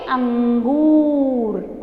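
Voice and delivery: "अंगूर" very slow, with a held ng; pronunciation demo. Shown as a demonstration. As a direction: hum the nasal and stretch "अंगूर" to the end. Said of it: "तो हम इसे कैसे बोलेंगे?". ("अंगूर" बहुत धीरे, अनुस्वार खींचकर)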